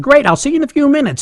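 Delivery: high, quick, jolly sign-off; jolly manager. Retold or imitated. Store manager ending the call with new hire, jolly and brisk. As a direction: imitate an upbeat manager hurrying off the phone. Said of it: "a jolly voice on the other end".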